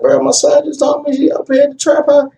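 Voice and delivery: high, pressing urgent voice; urgent call. Retold or imitated. Man calling his friend about his son's mother, urgent and high. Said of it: "I was like".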